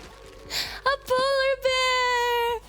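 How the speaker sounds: very high, long drawn-out coo; excited squeal. Real, not acted. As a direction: stretch every word into a delighted squeal. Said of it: "I got really excited because it's so cute".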